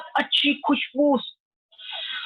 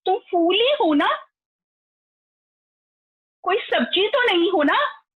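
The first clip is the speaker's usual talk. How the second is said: higher, sassy little bee voice; cheeky bee; 5 semitones higher than usual. A narrator's reading. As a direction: tease the grumpy flower. Bee answers back the lotus.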